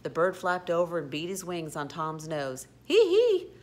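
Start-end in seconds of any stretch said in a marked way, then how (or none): 2.9-3.5 high, loud "hee hee" laugh; piercing character giggle; gleeful cackle. A narrator's reading. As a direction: cackle like a tickled scoundrel. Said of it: "laughed O'Grady".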